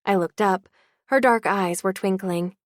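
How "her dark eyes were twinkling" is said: ordinary delivery, nothing marked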